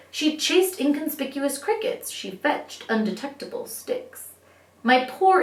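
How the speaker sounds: ordinary delivery, nothing marked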